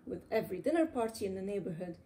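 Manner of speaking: ordinary delivery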